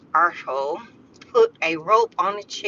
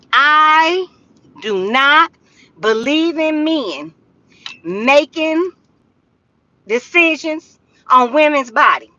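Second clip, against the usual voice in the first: each word set apart, higher and louder; emphatic word by word; 7 semitones higher, 5 dB louder than usual. Real, not acted. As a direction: hammer every word home. Political rant, emphatic pauses.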